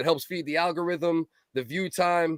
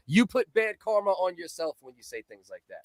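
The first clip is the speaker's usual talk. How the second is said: ordinary talk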